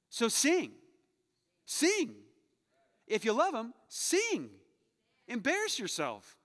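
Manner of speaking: loud, high, fired-up exhortation; rally the whole room to sing out; full-force preaching. Acted. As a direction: pastor pushing the church to sing, loud and rising. Pastor urges congregation to sing.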